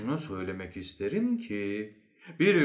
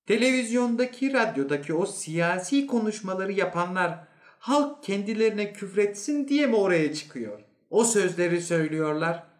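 high-pitched indignant challenge; indignant inspector; 8 semitones higher, 3 dB louder than usual. Acted. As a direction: challenge him in a raised, disbelieving voice. Inspector-type man challenges the psychologist.

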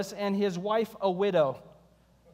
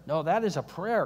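normal talking voice

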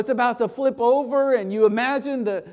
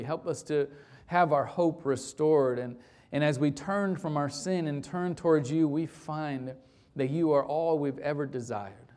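prayer; 7 semitones lower, 4 dB quieter than usual. Acted. Pastor praying quietly over the congregation, hushed. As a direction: lay it before the Lord gently.

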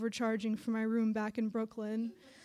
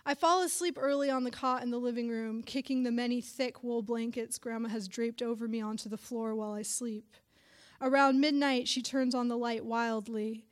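ordinary delivery, nothing marked

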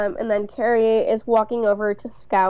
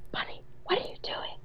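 hushed, voiceless whispered question; 15 dB quieter than usual. Acted. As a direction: whisper as if someone is sleeping. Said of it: "this is all in whisper".

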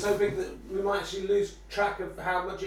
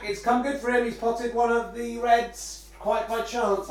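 ordinary talk, nothing marked

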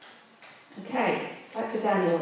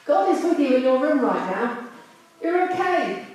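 dad yelling back; 6 semitones higher than usual. Retold or imitated. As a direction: call back to reassure without getting up. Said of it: "and he yells back".

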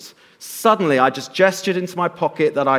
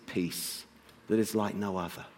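hushed; 8 semitones lower, 15 dB quieter than usual. Real, not acted. Hushed close of the point.

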